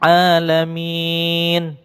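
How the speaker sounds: Alamin with a long held a; drawn-out Alamin. Shown as a demonstration. Teacher models the long vowel for pupils. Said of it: "Ain ya".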